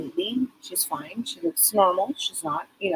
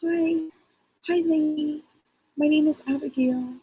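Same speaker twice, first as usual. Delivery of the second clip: put-on high, small child voice; child spirit introducing herself; little girl voice; 7 semitones higher than usual. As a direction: greet like a shy little girl. Psychic voicing child spirit.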